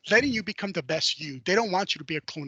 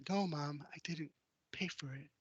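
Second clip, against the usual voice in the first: put-on much softer, sheepish child's admission; small guilty confession; 12 dB quieter than usual. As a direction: confess it in a tiny voice.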